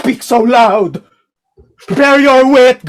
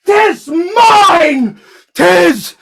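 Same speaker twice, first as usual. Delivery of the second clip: raised, anguished insistence; crying out; 8 semitones higher, much slower than usual. Acted. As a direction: cry it out, rising in pitch. Shylock repeats claim, crying out.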